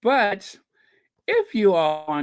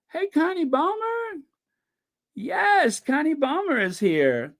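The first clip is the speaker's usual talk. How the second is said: very high-pitched, delighted hello; high excited greeting; excited welcome; 14 semitones higher than usual. Real, not acted. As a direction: announce a surprise guest in a high, gleeful voice. Greets a viewer who just appeared.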